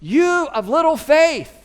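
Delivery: slow, loud and high, called out over the wind; loud rebuke. Retold or imitated. Jesus on one elbow yells at disciples, amused. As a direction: shout it slowly over the storm. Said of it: "And he says loudly".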